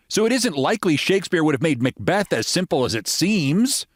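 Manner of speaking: lightly voiced American lady accent; a hint of a general American voice; standard American accent. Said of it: "said the American lady".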